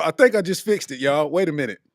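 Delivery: ordinary delivery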